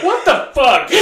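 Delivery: high, startled exclamation breaking into laughter; high-pitched stunned reaction; retold shock. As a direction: yelp it in mock alarm.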